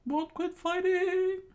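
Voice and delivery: very high, loud, slow squeaky echo; Sanji echoing. A narrator's reading. Sanji repeating Zaf's words, high loud squeak. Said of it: "Sanji said".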